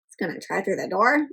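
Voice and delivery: plain reading voice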